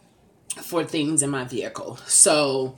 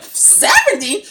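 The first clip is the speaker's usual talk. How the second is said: high, drawn-out shocked question; 6 dB louder, slower than usual. Retold or imitated. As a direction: stretch it out in shock. Shocked at the speed limit.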